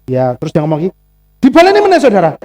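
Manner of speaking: normal talking voice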